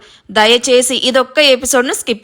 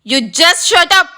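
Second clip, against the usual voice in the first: high, sharp shut-up; ringing snap; 5 semitones higher, much slower than usual. A narrator's reading. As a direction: cut him off hard. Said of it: "కంగుమని మోగిన Ayush voice".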